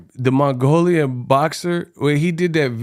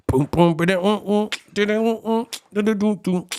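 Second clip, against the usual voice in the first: mock beatbox; 6 semitones higher than usual. Beatboxing a song beat.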